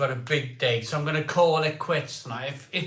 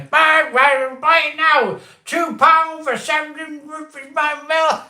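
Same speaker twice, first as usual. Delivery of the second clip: high, loud hard-sell pitch; over-the-top shopping channel announcer; hyped advert voice; 12 semitones higher, 7 dB louder than usual. Pretend. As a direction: shout it like a cheesy TV ad. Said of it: "I'm reading out an advert".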